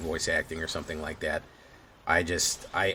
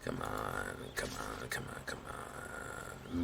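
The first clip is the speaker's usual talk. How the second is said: whisper; 9 semitones lower, 14 dB quieter, slower than usual. Real, not acted. Tense moment, urging under breath.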